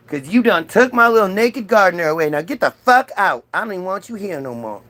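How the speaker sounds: high, emphatic mock-divine scolding; indignant God. God curses and banishes Adam and Eve, indignant. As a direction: lay down the punishment with sassy outrage.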